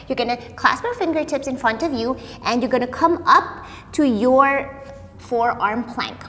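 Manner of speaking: usual speaking voice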